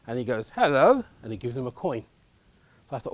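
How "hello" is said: high, louder cheery hello; mock jovial bobby greeting a child; friendly policeman. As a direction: boom a jolly hello back to the kid. Policeman answering the boy.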